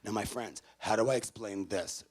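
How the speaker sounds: ordinary delivery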